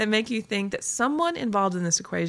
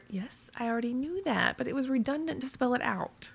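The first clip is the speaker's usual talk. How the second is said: higher, softer put-upon protest; exasperated mutter; 6 semitones higher, 4 dB quieter than usual. Acted. Blogger answering commenters who state the obvious, exasperated mutter. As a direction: mutter it as a pitched-up, huffy reply. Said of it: "force me to mutter".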